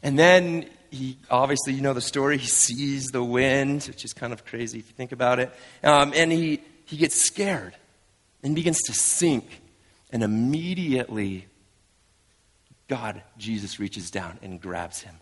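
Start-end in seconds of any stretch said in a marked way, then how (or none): none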